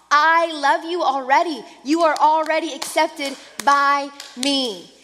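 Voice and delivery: loud, driving sermon delivery; fervent preaching. Acted. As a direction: preach it with conviction to the congregation. Preaching at full force.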